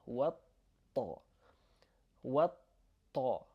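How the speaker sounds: والط- with the T held double; doubled T. Shown as a demonstration. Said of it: "we put two T's".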